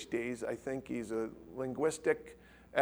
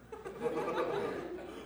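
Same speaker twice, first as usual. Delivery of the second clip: soft laugh; 10 dB quieter than usual. Real, not acted.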